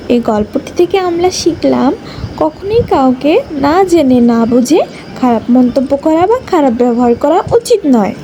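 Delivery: ordinary delivery, nothing marked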